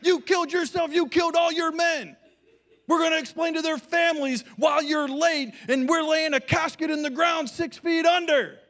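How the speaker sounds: high, loud screaming tirade; drill sergeant shout. Retold or imitated. Marine pastor screaming at his late youth pastor, furious high shout. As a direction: scream it like a Marine chewing out a recruit. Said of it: "cuts him off".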